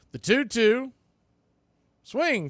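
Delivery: excited count call. Real, not acted. Radio announcer calling the count, high and loud.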